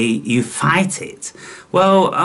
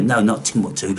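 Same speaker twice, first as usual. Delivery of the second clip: normal talking voice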